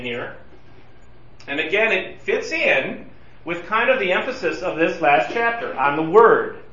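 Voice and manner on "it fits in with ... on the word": ordinary talk, nothing marked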